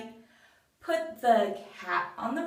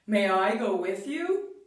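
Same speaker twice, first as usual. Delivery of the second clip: bull voice; 2 semitones lower than usual. Acted. Bull voiced, different timbre.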